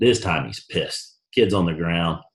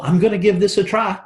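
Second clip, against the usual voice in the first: high, laughing parody of a half-hearted student; mock their weak commitment with a laugh; mocking imitation; 11 semitones higher than usual. Mocking hedging enrollees.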